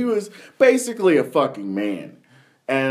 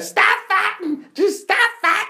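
put-on high-pitched old lady yelling; old lady bus driver. Old bus driver yelling at fighting kids, high and shrill. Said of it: "the bus driver was this, like, seventy-year-old fucking lady".

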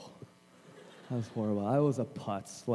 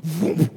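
sudden loud whooshing thud noise; imitated heavy drop; 8 dB louder than usual. His head suddenly gains fifty pounds, imitated whoosh. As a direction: make a comic plunging sound effect.

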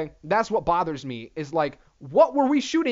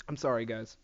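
ordinary delivery

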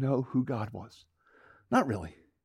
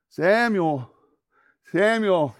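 raised, falling call; 5 semitones higher, 5 dB louder, much slower than usual. Acted. God calls the boy a third time, calling out. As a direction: call the name out firmly.

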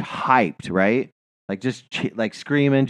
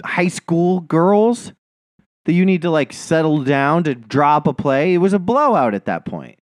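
real high-pitched, agitated rant; worked up; 7 semitones higher than usual. Real, not acted. Fan ranting to friend about the coach, worked up. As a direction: argue it hotly, pitched well up. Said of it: "You get so worked up".

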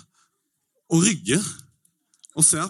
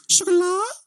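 sjokolade very high and loud, rising; squeaky high; 5 dB louder, slower than usual. Shown as a demonstration. Testing the word on locals as a squeal. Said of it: "i alle mulige tonefall".

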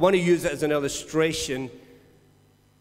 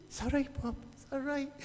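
soothing; 9 semitones higher, 11 dB quieter, faster than usual. Retold or imitated. Soothing the old man.

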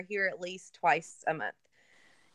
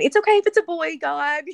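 high, loud mock plea to God; high-pitched retold prayer, louder; pleading with God; 9 semitones higher, 10 dB louder than usual. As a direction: beg God brightly, half-joking.

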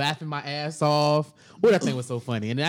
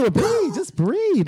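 coaxing him to breathe, pitched way up; high mock soothing; 11 semitones higher, much slower than usual. Teller mocking an angry caller, high sing-song. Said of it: "I said".

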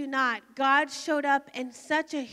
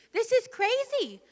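higher, panicked cry to God; frightened outburst in her own voice; 7 semitones higher than usual. Real, not acted. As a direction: let the fear jump up in pitch. Panicked prayer in the truck.

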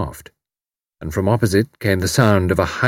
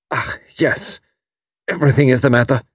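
hoarse; 5 semitones higher than usual. A narrator's reading. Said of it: "His voice was hoarse and unnatural".

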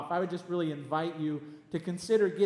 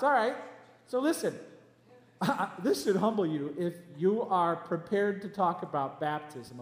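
usual speaking voice

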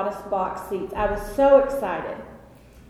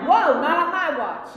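mock alarmed medic; 6 semitones higher than usual. Medics voiced stopping her.

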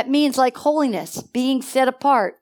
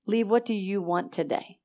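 soft voice of the Lord; 3 semitones lower, 8 dB quieter than usual. Retold or imitated. Voicing the Lord speaking.

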